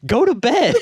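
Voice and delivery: high-pitched mock mom telling kids off; scolding mom. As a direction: imitate an outraged mother, voice shooting up. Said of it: "she's like".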